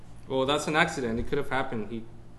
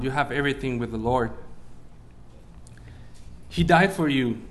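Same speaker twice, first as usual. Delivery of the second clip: ordinary talk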